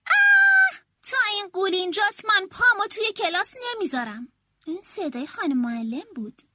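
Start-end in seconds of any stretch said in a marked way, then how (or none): 0.1-0.8 very high, very loud terrified shriek; teacher's scream. A narrator's reading. Teacher seeing the monster child at the door, terrified scream. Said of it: "جیغ کشید". 1.0-4.3 loud, higher panicked refusal; frightened teacher shouting. A narrator's reading. Teacher refusing to enter class with the ghoul, panicked shout. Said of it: "جیغ کشید".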